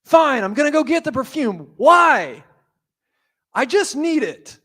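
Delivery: a hammed-up excited Mary; Mary voiced. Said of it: "And she says".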